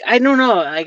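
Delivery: ordinary delivery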